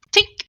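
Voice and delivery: high tink sound effect. Pretend.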